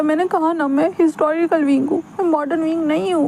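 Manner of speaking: put-on a touch of a wounded young man insisting; earnest young man.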